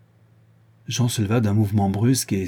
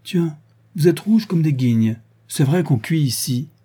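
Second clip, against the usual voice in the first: lightly voiced young boy, higher pitch; a hint of boyish excitement; boy, teasing; 6 semitones higher, 4 dB louder than usual. A narrator's reading. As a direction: blurt it out like a cheeky kid.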